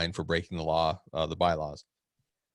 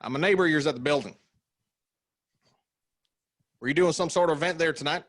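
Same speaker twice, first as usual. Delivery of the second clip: higher, faster Southern twang; Southern accent; 7 semitones higher, faster than usual. Acted. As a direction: hurry the repeat in a high twang. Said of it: "the accent that you did".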